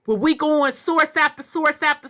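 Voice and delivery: heated. Real, not acted. Arguing with a commenter.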